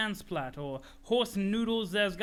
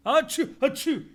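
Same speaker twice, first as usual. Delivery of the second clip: high, loud hammed-up sneezes; cartoon allergy sneezes; loud fake sneezes; 7 semitones higher, 10 dB louder than usual. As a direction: sneeze twice, big and high. Character sneezing.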